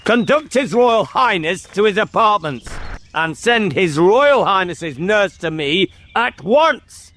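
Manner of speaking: high, imperious royal order; cold voice. Acted. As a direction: command coldly and formally. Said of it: "said in a cold voice".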